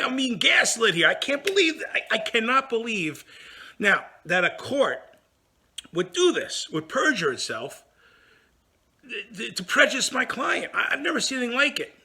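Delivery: mock outraged rant about a court; retold outrage. Telling how he ranted at being gaslit, indignant.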